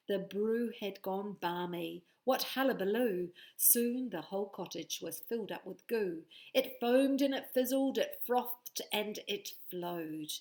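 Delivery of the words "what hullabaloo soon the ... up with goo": ordinary delivery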